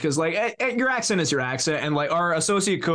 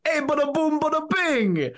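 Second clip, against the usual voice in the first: high, hammy New York tagline; exaggerated New York pitchman, pitched up; 11 semitones higher than usual. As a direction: belt out a cartoon New York Italian accent. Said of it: "it's a New York stereotype".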